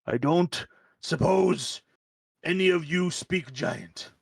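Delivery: put-on slow, halting old giant voice; ponderous elder with drawn-out words; frost giant elder. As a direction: draw the words out like an aged giant. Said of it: "gets on his cane and comes over and he's like".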